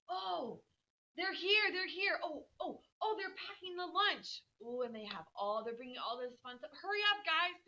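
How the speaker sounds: high excited chatter, calling out from the window; breathless little sister reporting every detail; excited Beth. Retold or imitated. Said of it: "she's saying".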